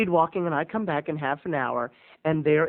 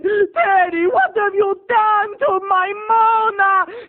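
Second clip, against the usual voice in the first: very high, loud, slow wail; hammed-up distraught mother crying out; 8 dB louder, much slower than usual. Mother rushing in to the crying Mona, loud high wail. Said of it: "Her mother flung for the dining room and said".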